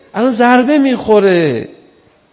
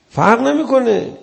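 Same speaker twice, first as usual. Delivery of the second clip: ordinary talk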